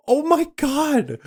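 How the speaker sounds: high, loud, drawn-out disbelief; shocked outburst. Retold or imitated. Retells own shocked reaction to punch.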